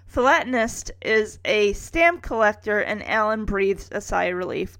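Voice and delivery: ordinary talk, nothing marked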